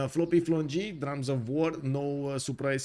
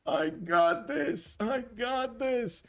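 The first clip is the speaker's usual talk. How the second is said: high, strained mock battle cry; mock screaming swordsman; 9 semitones higher, much slower than usual. Swordsman screaming to the archer to get back, high mock scream. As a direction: call out high, stretching the words. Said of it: "that swordsman is screaming to the archer".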